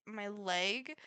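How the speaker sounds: ordinary talk, nothing marked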